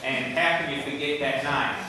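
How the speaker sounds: usual speaking voice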